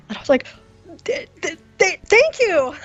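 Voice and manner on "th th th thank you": much higher, stuttering thank you; nervous stammer. Retold or imitated. To the dean after the job interview, stammering and nervous. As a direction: trip over the th- sound like a nervous wreck. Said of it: "I am a nervous wreck".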